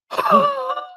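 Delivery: high, strangled wheezing gasp; imitated gasp for breath. Imitating a winded trainee trying to breathe, high gasp. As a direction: mimic sucking air back after a gut punch. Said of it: "And he goes".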